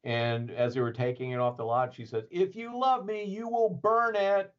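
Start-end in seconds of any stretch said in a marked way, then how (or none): 2.3-4.5 mock dramatic plea. Yvonne's dramatic demand, quoted.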